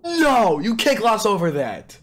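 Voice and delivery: high, insistent disbelief; emphatic amazement. Real, not acted. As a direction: start high on a cry, then slide down. Insists the art is amazing.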